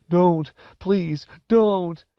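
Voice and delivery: high, wailing sobbed plea; terrified tearful begging; sobbing. Acted. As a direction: plead through sobs as you run. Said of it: "Wendell was sobbing as he ran".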